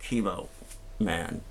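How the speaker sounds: deflated, quiet superhero call; worn-out hero. Retold or imitated. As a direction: limp out exhausted after a long day. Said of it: "after eight hours of infusion".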